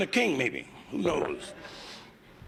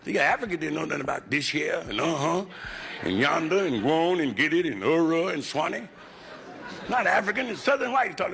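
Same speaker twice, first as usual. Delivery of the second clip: normal talking voice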